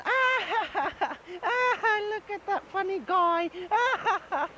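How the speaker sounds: ghostly voices mocking the intruder; mocking spirit laughter. Invisible spirits jeer at the thief, very high eerie laughter. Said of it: "bunch of people laughing".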